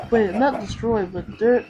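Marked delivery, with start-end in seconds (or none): none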